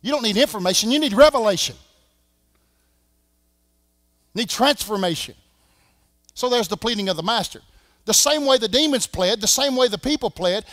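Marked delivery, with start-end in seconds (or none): 0.0-5.3 rising preacher's call, louder on revelation; preaching build. Acted. Preaching at full force. 8.0-10.6 loud, rhythmic pulpit chant; full-voiced sermon rhythm; preaching cadence.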